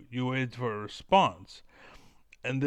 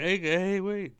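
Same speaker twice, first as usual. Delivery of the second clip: high, loud retold call to stop someone; mock urgent calling-out; 8 semitones higher, 6 dB louder, slower than usual. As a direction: call after them in a raised, higher voice. Acting out himself calling someone back.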